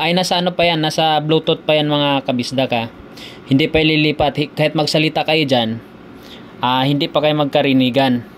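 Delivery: ordinary delivery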